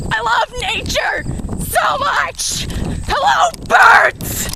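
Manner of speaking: very high, loud gleeful yell building up; joyful shout. Real, not acted. Running through a field calling to birds, high ecstatic shout. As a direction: scream your love to the birds.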